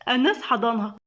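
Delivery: usual speaking voice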